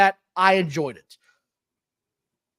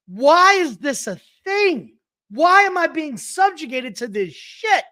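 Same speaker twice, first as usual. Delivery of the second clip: drawn-out, higher-pitched exasperated rant; loud exasperation; 4 semitones higher than usual. Retold or imitated. Ranting at the wrestling match he watched, loud exasperation. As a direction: wail it in disgusted disbelief. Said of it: "Sorry if I'm getting loud".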